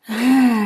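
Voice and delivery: long relaxed sigh; nice sigh. Shown as a demonstration. Meditation leader showing the group how to let go, relaxed sigh. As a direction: let out a slow, easy sigh. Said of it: "And a nice sigh".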